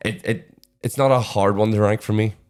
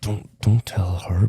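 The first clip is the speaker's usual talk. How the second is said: secretive aside; slower than usual. Pretend. Secret kept from Holly.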